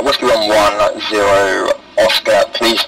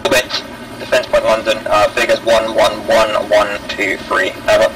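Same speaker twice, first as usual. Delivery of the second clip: radio voice; 5 semitones lower, 3 dB quieter than usual. Real, not acted. Orders over the radio.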